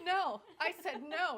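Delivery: high, loud, proud retold no; loud defiant no. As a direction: shout the no with pride. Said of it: "Did you hear that?".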